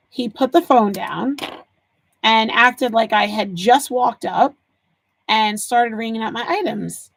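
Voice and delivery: ordinary talk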